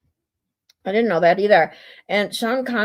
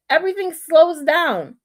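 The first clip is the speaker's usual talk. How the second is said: ordinary talk, nothing marked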